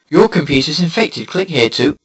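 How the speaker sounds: loud alert, lightly put on; slightly hammed-up computer warning; mock popup warning. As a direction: imitate an urgent fake virus alert. Imitates spoof warning popup.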